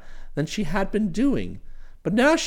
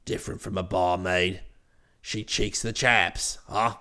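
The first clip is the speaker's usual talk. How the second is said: much lower, hearty brash chap; cheery voice; 13 semitones lower, 5 dB quieter than usual. Acted. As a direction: hail him with cheery familiarity. Bingley voiced again.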